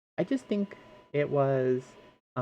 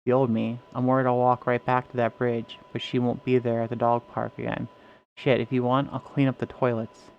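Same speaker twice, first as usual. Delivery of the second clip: dramatic read-aloud of the story, characters voiced; 2 semitones lower, 6 dB louder than usual. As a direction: read it like a tense therapy scene. Reading SCP story in character.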